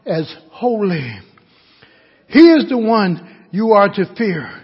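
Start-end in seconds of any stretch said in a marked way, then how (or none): none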